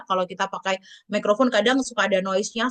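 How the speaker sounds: usual speaking voice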